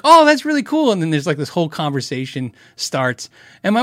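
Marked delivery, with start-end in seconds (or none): 0.0-0.9 high, loud gushing barista; put-on voice leaping high and loud; imitated enthusiasm. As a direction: mimic a server being keenly impressed. Coffee shop worker's keen reply.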